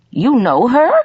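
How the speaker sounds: high, astonished question; faltering. A narrator's reading. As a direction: ask it in startled disbelief. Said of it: "she faltered".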